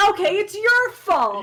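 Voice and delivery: loud, high, drawn-out accusation; exclaimed blame. Real, not acted. Friend blaming the mistranslator after years of confusion, loud exclamation. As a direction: pin the blame on them loudly, pitch jumping up. Mock blame once mystery is solved.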